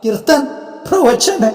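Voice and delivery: each word thrown back with a high, rising disbelief; incredulous echo. Acted. Ghost to God, echoing his advice in disbelief. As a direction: repeat the words as if they are absurd.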